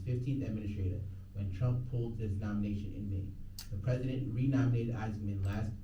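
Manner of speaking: steady broadcast news delivery; news read. Real, not acted. Radio DJ reading the news update, broadcast voice. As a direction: read it like the on-air newscast.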